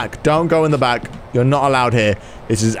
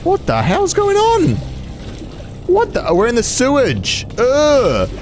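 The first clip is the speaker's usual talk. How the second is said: freaked out; 10 semitones higher than usual. Real, not acted. Sees monster in sewer.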